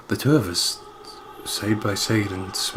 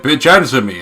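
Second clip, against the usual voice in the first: mock demand; 5 semitones higher, 8 dB louder than usual. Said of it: "He was like".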